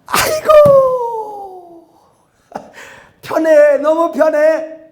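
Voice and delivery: drawn-out high, loud sigh of comfort; slow, laughing mock relief; mock relieved sigh. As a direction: ham up a lazy churchgoer sinking into ease. Lazy believer enjoying online worship.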